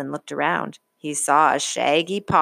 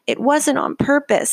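sniffling; 8 semitones higher, 4 dB louder than usual. A narrator's reading. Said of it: "sniffled Franklin".

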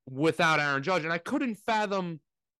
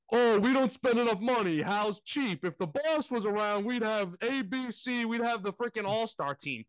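higher, mocking whine of a spoiled fan; a lightly voiced spoiled Yankee fan complaining; sneering fan impression; mock whiny fan; 5 semitones higher than usual.

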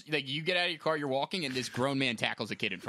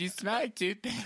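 laughing; 5 semitones higher, 4 dB quieter, slower than usual. Real, not acted. Teasing, laughing.

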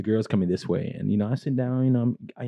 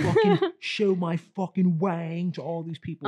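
put-on British accent; 9 semitones higher than usual. Imitating a British friend's party talk, in a high hammed-up British accent. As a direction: ham up a rugby-bro British accent. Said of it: "So he's like".

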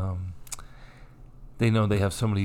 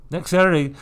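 higher, louder mock official notice; bossy announcement; 8 semitones higher, 3 dB louder than usual. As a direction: bark it like a pushy memo. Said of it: "They're like".

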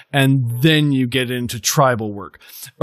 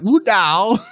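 LU DAO higher, mimicking a Chinese speaker; imitated Chinese accent; 7 semitones higher than usual. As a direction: say "Lu Dao" like a native Chinese podcaster, pitched up. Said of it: "this Chinese guy is, like, saying it".

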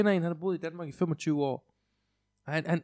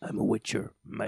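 put-on Cockney accent; 4 semitones lower than usual. Imitating an actor as his fantasy hero, Cockney accent. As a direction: lower mock Cockney voice. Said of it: "Og bare Cockney accent".

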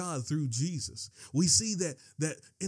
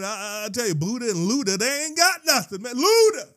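high, loud, worked-up preacher; excited preaching; 6 semitones higher, 7 dB louder than usual. Real, not acted. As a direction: pitch climbing into excited shouts. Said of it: "oh, I'm getting excited".

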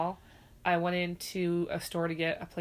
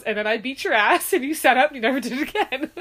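raised, louder retold scolding; mom scolding; 7 semitones higher, 9 dB louder than usual. Retelling mom's story about the tantrum, loud scolding. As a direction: replay mom's stern telling-off, raised up. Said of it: "She goes".

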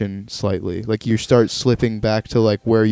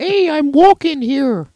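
put-on slow, high caricature with Brooklyn accent; high Brooklyn holler, stretched out; 14 semitones higher than usual. Host repeats the imagined Brooklyn professor, high Brooklyn accent. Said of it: "if he grew up in Brooklyn".

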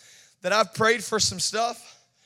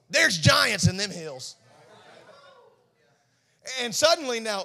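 ordinary talk

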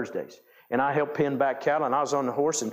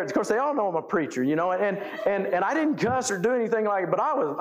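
usual speaking voice

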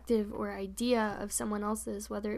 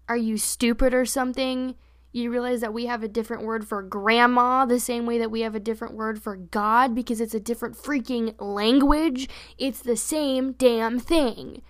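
loud, scornful comeback to a bully; indignant retort; 7 dB louder than usual. Retold or imitated. Telling off the bully at school, loud and scornful. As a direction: talk down to him, loud and fed up.

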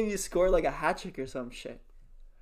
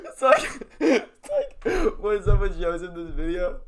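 laughing; 3 semitones higher, 4 dB louder, much slower than usual. Real, not acted. Laughing while describing coach.

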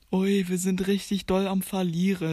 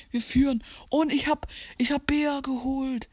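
ordinary talk

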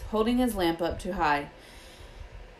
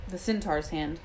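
plain reading voice

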